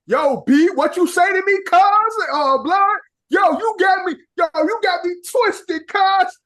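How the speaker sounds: hammed-up New York accent, pitched high; fake New York accent. Chicagoan trying New York street talk, fake New York accent. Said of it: "how to fake a New York accent".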